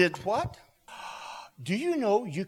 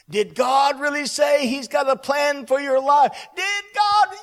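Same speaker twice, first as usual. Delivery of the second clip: high, loud, building shout; enemy's shouted challenge; 8 semitones higher, 6 dB louder than usual. Acted. Enemy's question thrown out by the preacher, loudly shouted. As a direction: shout the doubting question, climbing higher. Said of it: "the voice of the enemy is trying to speak".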